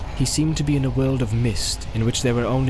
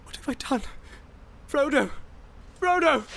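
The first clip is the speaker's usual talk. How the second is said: much higher, desperate calling; 14 semitones higher, 5 dB quieter than usual. A narrator's reading. Boromir calling after Frodo in despair. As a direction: call after him in regret. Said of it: "he called".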